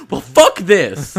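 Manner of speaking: loud, slow, drawn-out exasperated outburst; mock outburst. Friend retelling price jumping in his cart, loud slow outburst. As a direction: blurt it out loud and slow, fed up. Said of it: "and I was like".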